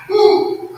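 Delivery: loud, high laughing woo; joyful woo. Real, not acted. Preacher shouting for joy mid-sermon, loud high woo.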